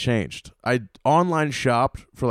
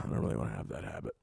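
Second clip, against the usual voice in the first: whisper; 10 semitones lower, 15 dB quieter, faster than usual. Real, not acted. Repeats the same phrase, then whispers it.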